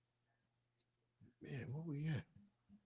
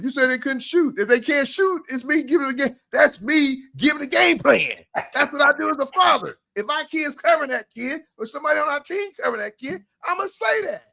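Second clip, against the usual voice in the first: fired-up rant; 8 semitones higher than usual. Real, not acted. Host scolding sensitive parents on air, heated rant. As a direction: get worked up and lecture. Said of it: "All right, take a breath".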